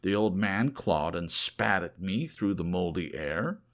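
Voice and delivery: ordinary delivery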